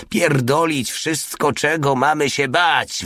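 high, drawn-out mock yell; narrated yell. A narrator's reading. Reckless driver defies his frightened comrade, restrained high yell. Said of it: "wrzasnął Porta".

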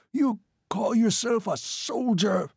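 high, slow, shrill outrage. A narrator's reading. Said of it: "began to shrill".

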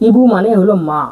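ordinary talk, nothing marked

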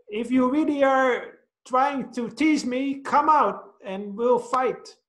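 high, slow boy's challenge, mock angry; a small boy hammed-up angry at an echo; angry little boy. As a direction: dare the hidden kid to come out and fight. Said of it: "made him even more angry".

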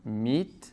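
"Meat" with a long ee, low and soft; "Meat" alone, ea said as ee; ea as Spanish i. Shown as a demonstration. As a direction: model "Meat" as "miit". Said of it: "Tenemos otra palabra, por ejemplo".

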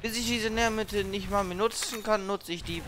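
ordinary delivery

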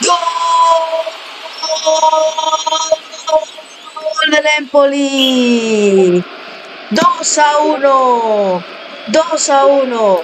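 slow, stretched shouted goal call; drawn-out goal call. Acted. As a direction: drag out every word of the goal.

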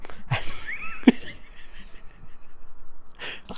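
very high breathless laugh; squealing laughter; shrieking laugh. Real, not acted. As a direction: lose it completely. Reader laughs at the text.

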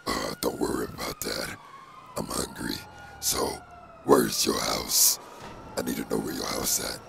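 whispered symbiote voice; breathy whispered monster; Venom whisper. Acted. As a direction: rasp it out as the creature in his head.

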